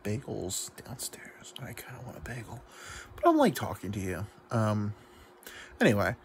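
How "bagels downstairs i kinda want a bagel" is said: whispered aside. Real, not acted. Confides he wants a bagel.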